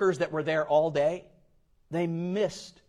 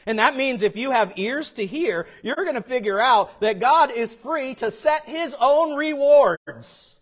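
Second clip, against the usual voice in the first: rising, louder pulpit build to rewards; full-force preaching; 11 semitones higher, 10 dB louder than usual. Acted. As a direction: drive the point home to the congregation.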